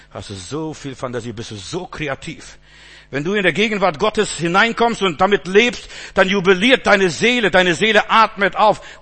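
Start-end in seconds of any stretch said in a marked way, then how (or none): 3.1-9.0 louder, swelling, joyful sermon build; rising preaching. Acted. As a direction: lift the congregation up. Rising praise of God's presence.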